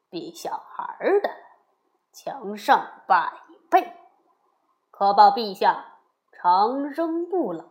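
sly court adviser, measured and forceful; scheming royal father-in-law. A narrator's reading. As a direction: press the advice on the king, leaning hard on key words. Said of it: "国丈却笑着说".

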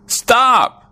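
sudden high-pitched cry to halt the goat; high shout of stop. A narrator's reading. Farmer and wife yelling at the goat eating plants, high cry. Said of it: "said the farmer and his wife".

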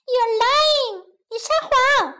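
high accusing creature voice; disbelieving gingerbread man. A narrator's reading. Gingerbread man doubts the woman, high accusation. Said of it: "生姜面包人不相信".

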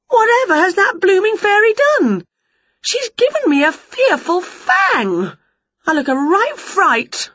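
fuming at the fairy; outraged witch. A narrator's reading. Winnie seeing her fang, high outraged witch.

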